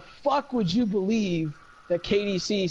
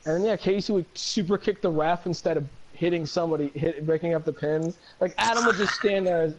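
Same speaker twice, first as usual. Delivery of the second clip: ordinary delivery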